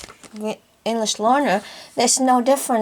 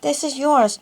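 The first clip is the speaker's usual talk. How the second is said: normal talking voice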